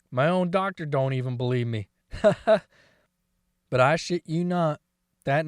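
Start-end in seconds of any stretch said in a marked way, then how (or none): 2.1-2.6 short raspy laugh; quick amused chuckle. Real, not acted. Laughs at doctor's disbelief.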